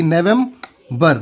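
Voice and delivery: "November" slowly, nevem... ber; broken into syllables. Shown as a demonstration. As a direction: pause before the last syllable of "November". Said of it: "तोड़ तोड़ के".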